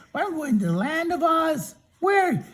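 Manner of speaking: high, relieved Tin Man; storyteller voicing a high grateful Tin Man. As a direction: pitch up as the freed Tin Man. Tin Man talks to Dorothy.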